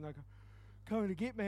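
normal talking voice

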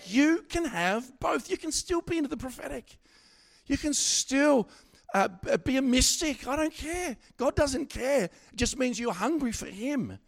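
high, slowed preacher's cry; full-force preaching. Acted. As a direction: preach it out to the room, lifting each line.

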